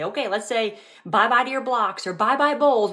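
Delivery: put-on cheerful sing-song to toddler. Mom coaxing her toddler away from his toys, sing-song. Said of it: "I say".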